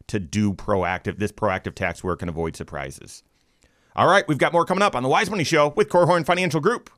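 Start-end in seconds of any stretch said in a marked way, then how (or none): 3.9-6.9 higher, quicker announcer voice; radio show sign-off. Acted. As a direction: punch out the segment tease at a brisk clip.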